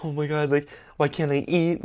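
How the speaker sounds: anxious high retelling; mock panic. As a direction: act out mounting panic. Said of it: "I started panicking even more".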